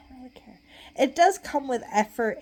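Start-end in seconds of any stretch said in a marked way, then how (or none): 0.1-0.6 sudden unvoiced whisper. Real, not acted. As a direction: drop to a whisper. Aside muttered to self.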